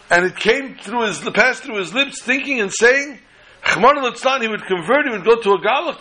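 high, anguished outcry, retold; horrified self-reproach. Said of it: "was sick over this".

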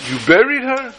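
a retold shriek of shock; mock screamed disbelief. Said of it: "he's screaming".

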